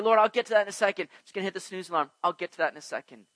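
fast, offhand put-off to God; hurried brush-off. Retold or imitated. Believer brushing off prayer, fast and offhand. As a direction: rattle off a lazy excuse. Acting out lazy excuse to God.

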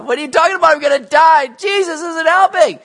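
loud, high-pitched acted protest; really bothered. Peter hears he will be killed, loudly bothered. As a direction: cry out in hammed-up exasperation. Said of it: "Peter's like really, you know, bothered by this".

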